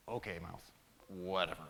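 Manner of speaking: low, unimpressed brush-off; dismissive lion. Acted. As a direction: wave the mouse away with a low voice. Lion dismisses the mouse's promise.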